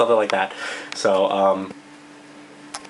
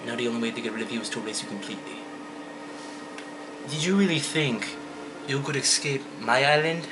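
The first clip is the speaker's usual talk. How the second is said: much softer, quiet menace; soft menacing villain; 9 dB quieter than usual. Shown as a demonstration. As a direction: threaten softly like a calm killer. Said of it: "an acceptable voice for Boss".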